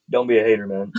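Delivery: low, cool deep-voiced comeback; imitating own deep voice. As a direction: drop low for a smug retort. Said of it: "in my very deep voice".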